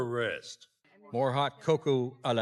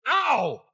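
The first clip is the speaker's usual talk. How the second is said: pained cry; 12 semitones higher, 5 dB louder than usual. Acted. Hit by another snowball.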